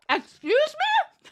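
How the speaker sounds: high, slow disbelieving laugh; stunned, laughing excuse me; shocked laugh. Real, not acted. Shocked by something in chat.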